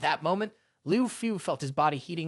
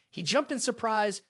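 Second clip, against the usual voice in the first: usual speaking voice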